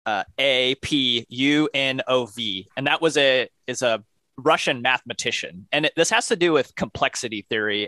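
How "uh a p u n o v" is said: slow, letter-by-letter spelling; one letter at a time, unhurried. Shown as a demonstration. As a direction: spell it out slowly for listeners. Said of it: "it's spelled".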